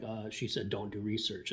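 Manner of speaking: low, quiet, dead flat confirmation; flat deadpan repeat. Retold or imitated. Confirming to Jeff what the president just said, low and deadpan. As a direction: drop it low and flat, "Don't do research" stated like a plain fact. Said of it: "I'm like".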